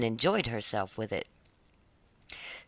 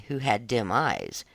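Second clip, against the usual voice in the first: usual speaking voice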